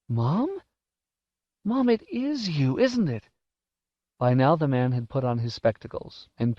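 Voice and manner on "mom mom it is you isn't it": high, hopeful questioning; boy daring to believe it is his mother; stunned recognition. A narrator's reading.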